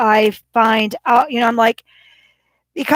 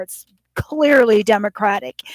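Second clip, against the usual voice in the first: usual speaking voice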